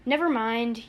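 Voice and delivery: plain reading voice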